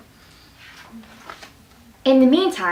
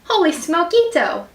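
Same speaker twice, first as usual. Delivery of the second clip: higher-pitched storybook exclamation; excited exclamation; 6 semitones higher than usual. A narrator's reading. Skippy Jones spots himself in the mirror, excited exclamation. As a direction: exclaim it with mock amazement. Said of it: "exclaimed Skippy Jones".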